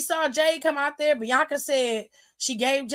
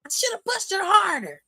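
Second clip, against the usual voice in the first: mock mad; 6 semitones higher than usual. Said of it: "Then she got mad and said".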